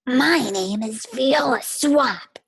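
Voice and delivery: slow, menacing scratchy voice; scratchy witch voice. Acted. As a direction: announce yourself like a witch. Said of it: "in a scratchy voice".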